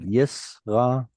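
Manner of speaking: Yisrael split into yis, ra, el; Yisrael, sounded out in separate syllables; syllable-by-syllable model. Shown as a demonstration. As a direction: say Yisrael slowly, a gap after each syllable. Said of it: "That's right. Good".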